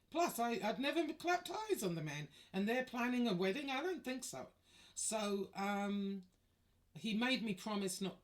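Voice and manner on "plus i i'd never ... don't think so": high, loud incredulous outrage; fired-up disbelief; indignant outrage. Real, not acted. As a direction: still worked up, voice pitched high. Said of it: "still outraged retelling".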